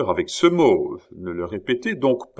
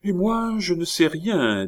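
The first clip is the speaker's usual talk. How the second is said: usual speaking voice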